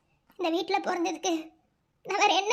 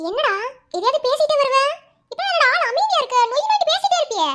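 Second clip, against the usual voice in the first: much higher and louder, singsong teasing; playful teasing; 7 semitones higher, 6 dB louder than usual. Acted. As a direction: needle him, grinning. Sister teasing quiet brother.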